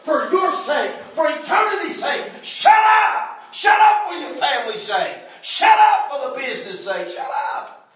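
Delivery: high, very loud "shut up" shouts; furious rebuke. Retold or imitated. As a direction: tear into them with everything. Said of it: "I think".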